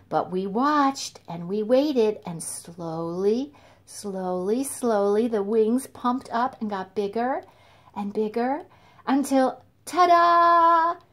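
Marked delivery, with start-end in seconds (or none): 2.4-5.3 drawn out. Shown as a demonstration. Wings slowly growing. 9.9-11.0 high, loud triumphant ta-da; ta-da reveal. Acted. Storyteller revealing the grown moth to children, triumphant.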